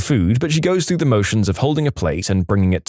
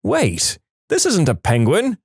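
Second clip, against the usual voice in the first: higher-pitched, puzzled blurt; sudden blurt; 7 semitones higher than usual. A narrator's reading. Partner realizing mid-sleep what they pet, blurting. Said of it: "blurts out".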